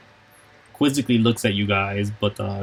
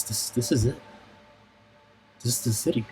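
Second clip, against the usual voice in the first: softly voiced Mixu; 8 dB quieter, faster than usual. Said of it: "she says".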